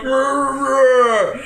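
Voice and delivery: strained wordless growl; pained grunt. Acted. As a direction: grunt through cramps instead of words. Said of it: "I just grunt".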